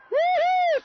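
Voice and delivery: announcer's jubilant whoop as the title is won; excited whoop. Real, not acted. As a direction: let out a big victory woo-hoo. Celebrating the winning free throws.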